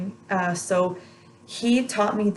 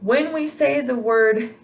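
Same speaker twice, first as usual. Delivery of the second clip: ordinary talk, nothing marked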